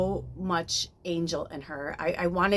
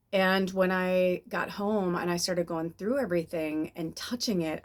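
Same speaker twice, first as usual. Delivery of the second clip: ordinary delivery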